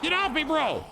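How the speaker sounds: high panicked shout; panicked, shove them off; panicked yell. Real, not acted. Grabbed by zombie.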